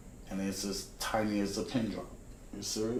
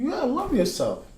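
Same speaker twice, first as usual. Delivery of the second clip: quoting God; 12 semitones higher, 7 dB louder, faster than usual. Retold or imitated. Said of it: "God talked to me".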